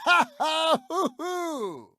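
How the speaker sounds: high mocking ha-ha-hoo-hoo cackle; high smug laughter trailing off quietly; gloating laugh. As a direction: laugh at the loser, enjoying it. Gloating over rival's loss.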